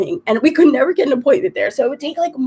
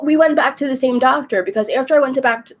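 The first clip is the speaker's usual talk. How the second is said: ordinary delivery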